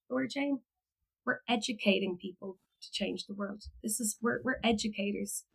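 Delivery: hushed, measured reassurance; calm professional. Retold or imitated. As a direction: quietly imitate an unruffled supervisor. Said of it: "And in her calm, like, consummate professional response".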